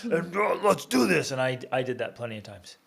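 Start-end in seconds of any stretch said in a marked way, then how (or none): 0.0-1.3 forceful dad. Retold or imitated. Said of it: "Dad's gonna be like".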